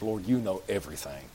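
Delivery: low, soft, weary plea; a worn-down man pleading his love; exasperated Peter. Acted. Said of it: "gets exasperated".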